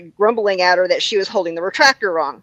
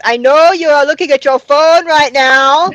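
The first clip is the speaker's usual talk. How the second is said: higher and louder Trinidadian voicemail voice; bossy Trinidadian voice, commanding; Trinidadian accent; 5 semitones higher, 7 dB louder than usual. Retold or imitated. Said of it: "she leaves the funniest voicemails".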